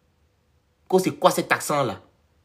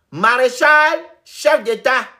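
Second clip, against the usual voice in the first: slow, loud, high proclamation; drawn-out, booming mock tribute; mock acclamation; 8 semitones higher, 6 dB louder, much slower than usual. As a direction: proclaim the title like a herald.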